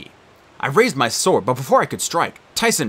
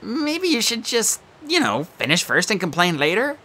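nervous; 9 semitones higher than usual. Acted. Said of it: "Grover cut in nervously".

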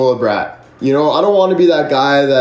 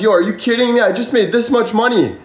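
higher, thrilled telling friends; excited phone call; 7 semitones higher than usual. Retold or imitated. Streamer retelling a call to friends, higher and thrilled. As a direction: share the big news. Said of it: "Calling my friends".

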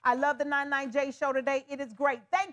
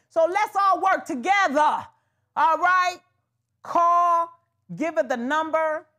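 louder urging; 4 semitones higher, 7 dB louder than usual. Real, not acted. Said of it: "urging callers to cooperate".

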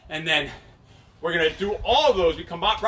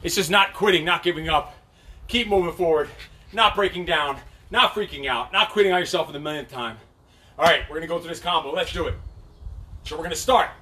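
usual speaking voice

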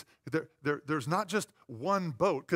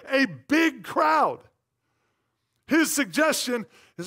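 loud, higher, driving sermon; full-force preaching; 7 semitones higher, 8 dB louder than usual. Acted. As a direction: preach it out to the congregation.

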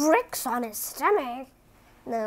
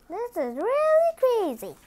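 higher, excited exclamation; exasperated; 10 semitones higher, 5 dB louder than usual. Real, not acted. Reader exclaiming at tongue twister, excited. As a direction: throw up your hands. Exclaims after tangled words.

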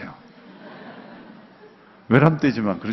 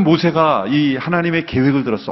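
usual speaking voice

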